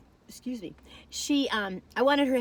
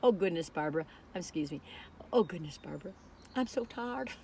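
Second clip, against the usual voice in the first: voicing the dog; 4 semitones lower, 6 dB quieter than usual. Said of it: "I think she was trying to say".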